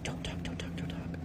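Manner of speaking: urgent whispered shushing; paranoid hushing whisper. Retold or imitated. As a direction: hush her in a paranoid whisper. Paranoid guy silencing her.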